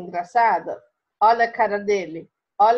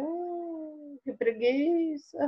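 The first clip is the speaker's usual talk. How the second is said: slow, lazy sigh of a sleepy cat; lazy Garfield; 4 semitones higher, 7 dB quieter, much slower than usual. Acted. Teacher voicing lazy Garfield in the comic, lazy drawl. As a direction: drag it out like a bored, lazy cat.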